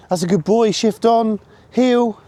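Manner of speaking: bright high-pitched call to the dog; high dog command. Real, not acted. Handler calling his puppy to heel, high and loud.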